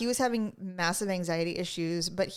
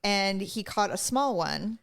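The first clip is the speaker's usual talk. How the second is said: ordinary talk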